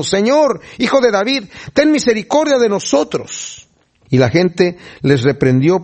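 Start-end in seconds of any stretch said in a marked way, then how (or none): 0.0-3.7 súplica leída en tono más agudo; clamando. A narrator's reading. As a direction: read it as a high-pitched cry for mercy. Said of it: "clamaron diciendo". (clamando con voz aguda)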